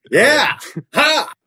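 high, loud cocky grin voice; imitating Tom Cruise. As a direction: Tom Cruise welcoming each newcomer, cocky high whoop. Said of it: "he's just like".

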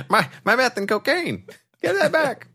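very high squeaky voice; squeaky mock villain. Friend joins the bit, squealing high as Bane.

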